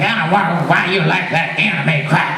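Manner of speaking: scornful parent ranting about anime; grumpy mom scoffing at anime crap; disapproving parent. Retold or imitated. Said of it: "parents that are like".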